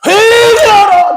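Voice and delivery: high, drawn-out call to repent; proclaiming cry. Retold or imitated. Preacher voicing the Lord's first words, crying out. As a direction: cry it out slowly like an urgent proclamation. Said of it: "첫 번째 하신 말이 뭐예요".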